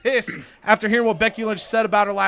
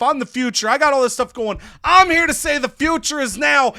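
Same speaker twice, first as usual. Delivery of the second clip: fired-up, louder wrestler's rant; furious promo; 4 semitones higher, 5 dB louder than usual. Retold or imitated. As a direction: play an indignant wrestler protesting, pitched up. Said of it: "is furious".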